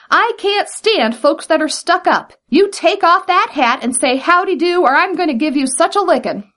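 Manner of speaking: high, indignant threat, speeding up at the end; angry scolding. A narrator's reading. Brer Rabbit threatening the rude tar baby, indignant. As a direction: work up into a huffy rant.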